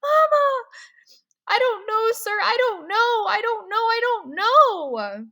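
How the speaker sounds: high, loud, breaking repeated denials; distraught crying. Acted. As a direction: fall apart under the questions. Boy breaks down under questioning.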